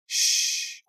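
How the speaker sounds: a hushed, breathy shush. A narrator's reading. Honey shushing Becca.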